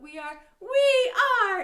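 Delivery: very loud, high chanted shout; big rallying sing-song shout. Shown as a demonstration. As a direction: yell it out with the kids.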